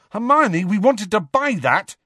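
high, loud indignant yell; shouting. Acted. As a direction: protest at the top of your voice. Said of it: "shouted Ron".

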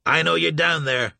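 loud shout down the stairs. A narrator's reading. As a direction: call out loud and challenging. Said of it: "he shouted".

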